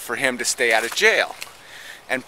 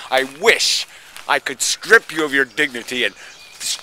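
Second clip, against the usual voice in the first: ordinary delivery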